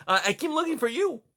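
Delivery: high, fast, eager little voice; young mouse. Acted. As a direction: blurt it out proudly.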